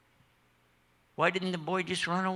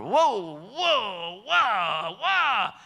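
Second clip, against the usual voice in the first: storm wails; 5 semitones higher, 4 dB louder, much slower than usual. Acted. Boat rocking in the storm.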